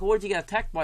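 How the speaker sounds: high mock Kiwi radio host; New Zealand accent. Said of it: "I've got it now".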